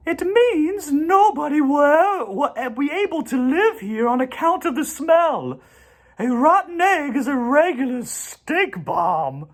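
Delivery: high, gentle spider voice; a kindly spider speaking up; spider's voice. A narrator's reading. Charlotte greets the goose family in a high voice.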